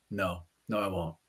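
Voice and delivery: low, subdued retold reply; quiet concession. Producer admits to him he won't forget, quiet concession. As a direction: concede softly, as if caught out. Retells producer's humbled answer.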